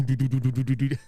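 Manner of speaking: imitating a speaker's blown bass thumps; speaker noise. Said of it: "to hear the speaker go do".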